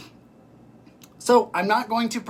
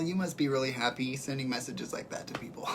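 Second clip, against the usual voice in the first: breathy sarcastic whisper, much softer; 3 semitones lower, 7 dB quieter than usual. Retold or imitated. Answering a Twitter troll, a sarcastic whisper. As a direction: whisper the reply like a sly aside. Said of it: "And I was just like".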